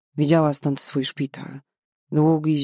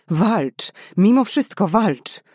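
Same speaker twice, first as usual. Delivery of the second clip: louder, higher, slowing cry building on "Mimo"; desperate shout; 5 semitones higher, 5 dB louder, slower than usual. Acted. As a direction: beg the dying girl to fight, pushing harder. Desperacka reanimacja pacjentki.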